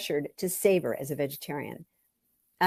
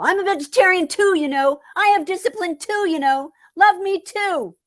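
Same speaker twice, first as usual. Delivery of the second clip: much higher and louder, hurt and pleading; pleading yell; 13 semitones higher, 6 dB louder than usual. Retold or imitated. Lisa yelling at her dad for recognition, high and pleading. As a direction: cry out to be noticed. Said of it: "I wanted to ... yell".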